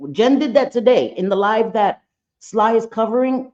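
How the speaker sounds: ordinary talk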